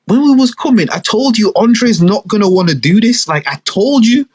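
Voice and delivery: high, insistent sister voice; sister arguing. Retold or imitated. Sister reminding mum she warned her, high. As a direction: press the point to mum. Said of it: "she's like".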